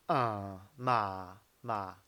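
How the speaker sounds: 马 dropped low each time; third tone. Shown as a demonstration. Teacher repeating the third tone for students, 马 low. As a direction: repeat 马 on a low, dipping pitch. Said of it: "三声是马".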